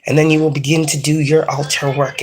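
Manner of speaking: ordinary talk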